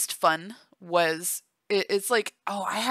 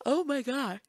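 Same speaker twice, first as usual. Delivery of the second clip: high, softer words stretched by a yawn; 6 semitones higher, 4 dB quieter than usual. Real, not acted. Pregnant vlogger surprised by her own yawn, yawning. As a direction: talk through a real yawn. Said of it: "I don't know why I'm yawning".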